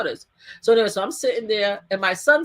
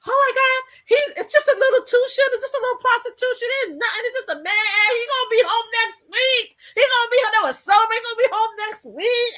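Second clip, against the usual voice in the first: mocking supporters; 12 semitones higher than usual. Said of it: "they're like".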